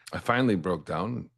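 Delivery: ordinary talk, nothing marked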